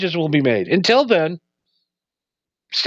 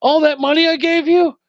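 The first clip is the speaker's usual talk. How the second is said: mock mobster outrage, pitched much higher; a gangster shocked at the priest's verdict, mock outrage in a much higher voice; outraged mobster; 11 semitones higher than usual. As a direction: squawk it like a betrayed gangster. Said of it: "he goes".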